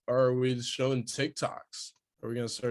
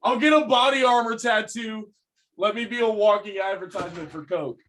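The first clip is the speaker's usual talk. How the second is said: high, loud excited holler; yelling; 10 semitones higher, 5 dB louder than usual. Real, not acted. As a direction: yell it out like a proud announcement. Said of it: "don't just yell".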